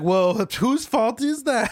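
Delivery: high, drawn-out blaming question; hammed-up indignant retort, stretched out; mock accusatory. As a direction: throw it back in their face, playfully. Acted blame game between kitchen and servers.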